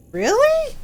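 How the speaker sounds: very high, squeaky really; incredulous. Retold or imitated. Streamer doubting she sounds like Tifa, very high and incredulous. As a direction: pitch it way up in disbelief.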